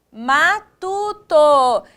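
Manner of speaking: MATUTO very slow and loud, syllables apart. Shown as a demonstration. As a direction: stretch out each syllable of "matuto". Teacher gives rhyme word by syllables. ("matuto" bem devagar, sílaba por sílaba)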